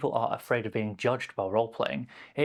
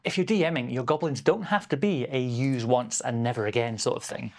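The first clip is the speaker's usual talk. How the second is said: normal talking voice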